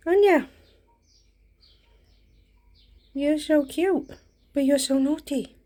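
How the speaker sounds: talking to a dog. Real, not acted. Cooing at the naughty dog.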